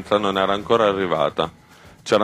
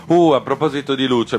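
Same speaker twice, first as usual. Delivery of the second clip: a sudden high little shriek, louder; 6 semitones higher, 4 dB louder than usual. A narrator's reading. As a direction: squeal it out as if startled. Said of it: "la signora lancia un piccolo strillo". (piccolo strillo acuto e improvviso)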